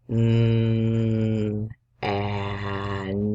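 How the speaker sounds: Mm held then an; sounding out. Shown as a demonstration. Teacher sounds out man for children. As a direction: hum Mm and add an. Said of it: "Sound that out".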